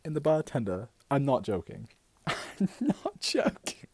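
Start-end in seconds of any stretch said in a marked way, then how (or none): 2.3-3.9 high, slow words through giggles; laughing. Real, not acted. Swearing the bartender story is true, laughing. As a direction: break up laughing mid-insistence. Cracks up while insisting.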